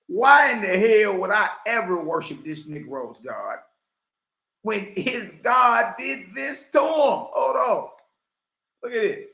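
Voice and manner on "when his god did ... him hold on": outraged shout. Real, not acted. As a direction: cry it out in disbelief. Loud outraged cry.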